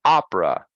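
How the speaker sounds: Opera as two syllables, middle vowel fully dropped, OP-ra; reduced two syllables. Shown as a demonstration. Teacher models Opera as OP-ra, two syllables, middle vowel gone. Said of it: "So it's only two syllables".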